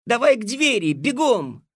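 loud urgent shout to run. Acted. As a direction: yell it at full volume. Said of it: "закричал Алёшка".

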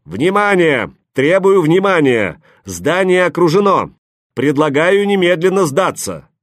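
loud, high megaphone announcement; hoarse megaphone voice. A narrator's reading. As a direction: bark it through a megaphone. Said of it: "мегафонный хриплый голос".

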